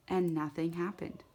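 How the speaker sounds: plain reading voice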